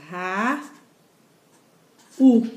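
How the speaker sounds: "Ra-, u" as two separate syllables; split into syllables. Shown as a demonstration. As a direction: say "Ra-" then "u", with a long pause between. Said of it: "Aí você faz a separação silábica".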